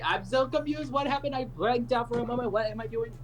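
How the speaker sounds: very high-pitched mock confusion; high, put-on bewildered voice; feigned confusion. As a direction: play baffled, voice way up high. Dodging a requested impression, feigning blankness.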